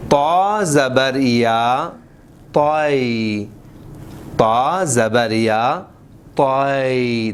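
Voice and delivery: تائی said slowly with a gliding, stretched vowel; rounded and drawn out. Shown as a demonstration. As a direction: roll the vowel of تائی and draw it out. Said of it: "گھما کے کھینچ کے پڑھنا ہے".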